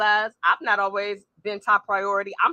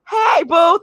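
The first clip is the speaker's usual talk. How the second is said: higher and louder hey boo; excited greeting; 9 semitones higher, 10 dB louder than usual. Real, not acted. Host greeting her friend Journey in the chat, higher louder excited greeting. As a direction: beam at a friend.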